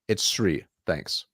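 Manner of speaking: "It's Shri" falling, "Thanks" dropping low and soft; downward inflection. Shown as a demonstration. Coach shows how to end the gatekeeper call, falling. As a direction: close it off with a falling tune. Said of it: "Notice, downward".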